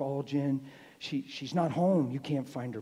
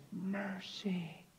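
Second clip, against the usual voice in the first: soft, raised, drawn-out utterance; the Lord's voice; 5 semitones higher, 8 dB quieter than usual. Retold or imitated. Preacher quotes the Lord's one word at the bedside, softly. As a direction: breathe it out gently and high. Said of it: "The Lord said".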